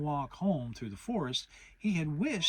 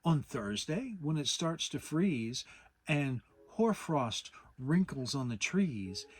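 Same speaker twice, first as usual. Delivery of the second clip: poem recital; 4 semitones higher than usual. Acted. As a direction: recite it like proud Pooh. Pooh recites his poem.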